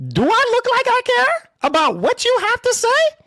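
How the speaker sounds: high, fast indignant outburst; sharp rising indignation. Real, not acted. Dismissive outburst at troll.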